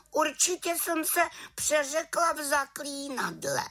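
very high, small witch voice; little witch. A narrator's reading. Little witch explains her slip in the spell, high voice. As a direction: excuse yourself as a little witch. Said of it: "pravila malá čarodějnice".

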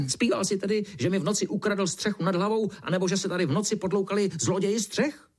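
high, indignant beetle rant; sputtering beetle. A narrator's reading. Beetle pressing the ant, sputtering. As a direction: sputter the question in a high, cross voice. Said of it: "brouk prskal dál".

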